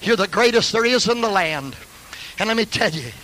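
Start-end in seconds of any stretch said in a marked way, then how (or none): none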